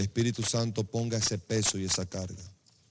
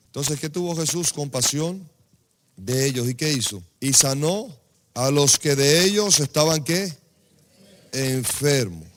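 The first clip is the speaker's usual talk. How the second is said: loud, rising preacher's call to the congregation; preaching at full force; 7 dB louder than usual. Acted. Preacher quizzing the congregation, loud and rousing. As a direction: drive it home and make them answer.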